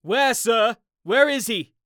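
high, urgent shouted question; loud, alarmed keeper's call; shouting. Acted. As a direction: shout as if running up. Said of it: "he shouted".

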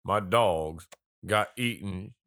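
slow hillbilly drawl; country accent. Retold or imitated. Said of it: "he talked like".

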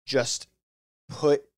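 ordinary delivery

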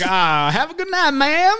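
high Southern accent imitation; exaggerated country-cousin Southern accent; mock Southern farewell. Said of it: "where he says, like, goodbye to".

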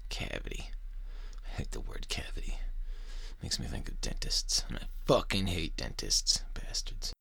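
hushed whispered aside. Real, not acted. Host mutters about hating dentists, whispering. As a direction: breathe it out as a private grumble. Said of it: "whispered aside on hating dentists".

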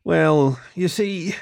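halting, with drawn-out little pauses; hesitant. A narrator's reading. Kit explains himself to his fox, hesitant. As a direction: stall as if unsure how to confess. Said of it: "Kit hesitated".

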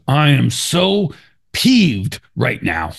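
drawn out slow, high and prissy; mock prim outrage. Coworker keeps his temper in a meeting, slow and high. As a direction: stretch it out like a fussy complaint. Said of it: "And he goes".

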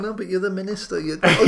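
high, playful urging breaking into laughter; mock insisting. Telling the reluctant minister to go, mock insistent. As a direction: push back in mock exasperation, laughing at the end. Acts out reply in minister joke.

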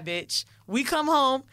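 usual speaking voice

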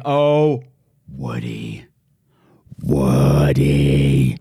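low, held name like a foghorn; low, drawn-out greeting; deep drawn-out call. Pretend.

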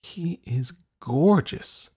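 slow, high-pitched swoon; drawn-out, smitten inner voice; awestruck thought. A narrator's reading. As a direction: stretch it out in dreamy admiration. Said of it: "Dorothy thought".

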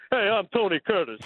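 a hint of old-time Tony Curtis swagger; Tony Curtis impression. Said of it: "It's kinda like this".

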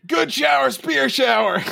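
high, playful battle yell. Acted. Player yelling before throwing a spear, mock battle yell. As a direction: call it out high like a mock war cry. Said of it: "And then I yell".

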